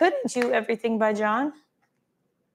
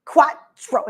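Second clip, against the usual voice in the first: lightly mimicked class countdown; a hint of a barking Pilates teacher; imitating Pilates instructor; much slower than usual. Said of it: "says it like that".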